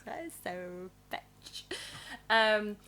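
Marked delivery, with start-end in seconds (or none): 0.1-1.7 breathy whisper, slower. Pretend. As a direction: whisper it like a secret. Quoting the film line.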